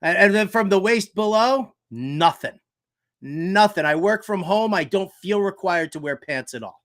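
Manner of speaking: usual speaking voice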